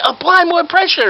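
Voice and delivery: very high, drawn-out command; urgent fake doctor. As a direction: order it with hammed-up urgency. Fake doctor orders helper.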